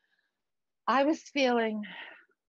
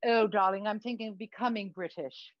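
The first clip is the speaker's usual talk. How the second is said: affected British show-business friend; camp, affected British accent; put-on British accent. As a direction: advise grandly in theatrical British. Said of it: "what's with the accent?".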